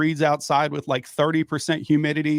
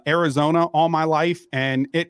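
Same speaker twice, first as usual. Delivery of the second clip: ordinary talk, nothing marked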